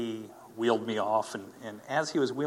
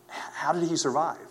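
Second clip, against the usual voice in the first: higher, louder astonished question; incredulous doctor; 6 semitones higher, 5 dB louder than usual. Retold or imitated. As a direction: voice a doctor who can't believe it. Retells doctor's disbelief at results.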